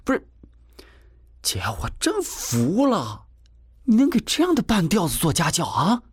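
exasperated, pitch climbing high; 对姐不满. A narrator's reading. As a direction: turn on your sister in disbelief, rising to a high-pitched challenge. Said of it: "贾成突然对姐有了意见". (不满地质问姐姐，音调越来越高)

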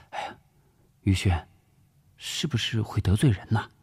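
a touch of wifely worry; worried. A narrator's reading. Wife worrying that her husband may offend people, mildly anxious. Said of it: "王必奎担心起来".

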